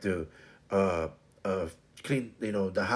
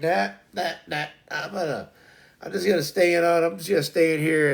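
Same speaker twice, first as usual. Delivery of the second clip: mock baby refusal; 4 semitones higher, 5 dB louder, faster than usual. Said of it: "He's like".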